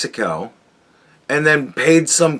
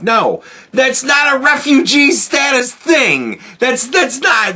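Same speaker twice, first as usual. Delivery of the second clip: outraged rant; 9 semitones higher than usual. Real, not acted. Ranting, outraged at refugee claims.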